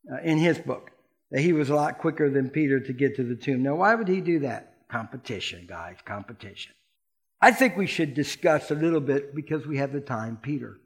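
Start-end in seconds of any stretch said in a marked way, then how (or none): 4.9-6.7 hushed aside. Real, not acted. Whispered punchline to congregation.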